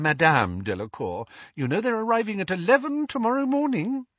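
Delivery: high, brisk motherly voice, quickening at the end; busy mother assigning chores to the children; bustling mother. Acted. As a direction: cheerfully hand out jobs to everyone. Mrs Weasley handing out chores.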